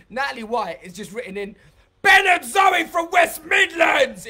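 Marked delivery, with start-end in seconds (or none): 2.0-4.3 loud, high, slow shouted read-out; shouting a comment. Acted. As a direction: shout the comment as if written in capitals. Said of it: "If you write in capitals, I might have to shout it out".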